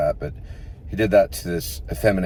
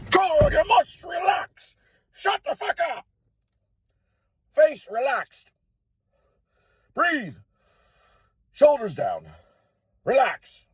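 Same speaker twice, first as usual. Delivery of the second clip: much higher, slow, exaggerated teacher coaching; acting teacher voice; slower than usual. Acting teacher telling the student to relax, hammed-up and high. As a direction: ham up a bossy drama coach.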